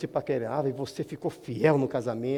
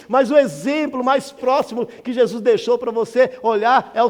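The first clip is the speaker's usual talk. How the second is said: louder, higher preaching to the church; full-force preaching; 8 semitones higher, 9 dB louder than usual. Acted. Pastor rousing the congregation, loud preaching.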